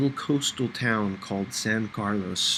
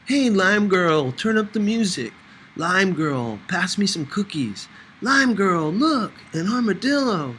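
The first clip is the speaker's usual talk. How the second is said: Angel's teasing voice; 8 semitones higher, 4 dB louder than usual. A narrator's reading. Angel teasing Sophie in the van, playful high calls. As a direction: tease her with the nickname, sing-song.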